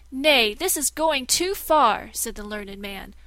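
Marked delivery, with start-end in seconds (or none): 0.1-2.1 loud, slow, higher outburst; a scholar crying out at the deceit; outraged protest. Acted. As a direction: refuse him with full force. Learned man outraged.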